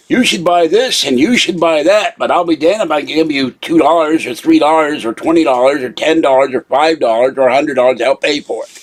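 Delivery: bitter sarcastic imitation; angry mocking quote. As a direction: sneer at the advisers' stinginess. Angry mock quote of advisers.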